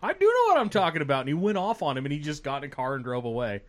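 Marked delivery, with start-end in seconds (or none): 0.0-1.2 higher, louder, fast comeback; mock heckler retort. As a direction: snap back at the prime minister. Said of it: "He's like".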